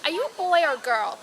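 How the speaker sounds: lightly voiced little sister; imitating the little sister. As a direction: ask it like a small curious child. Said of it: "her little sister came over later and was like".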